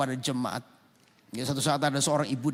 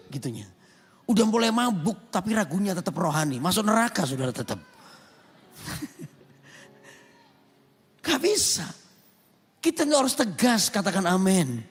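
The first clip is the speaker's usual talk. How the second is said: raised, forceful pulpit voice; preaching; 10 semitones higher, 5 dB louder than usual. Acted. Pastor preaching hard to his congregation, forceful. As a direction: drive the point home to the church.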